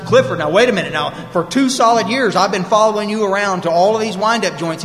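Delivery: retold objection, high and loud; indignant protest. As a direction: push back at your sponsor with mock outrage. Acted quarrel, sponsee protesting.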